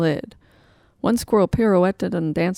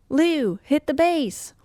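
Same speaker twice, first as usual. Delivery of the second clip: calling out; 9 semitones higher, slower than usual. Acted. Character calls out to bassist.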